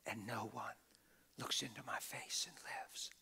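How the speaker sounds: soft unvoiced whisper. Preacher retelling God's whisper, hushed whisper. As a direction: breathe it out as a secret. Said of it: "then he whispered and said".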